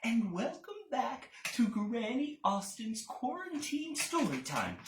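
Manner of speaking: put-on high, bright greeting to children; overbright kids party host.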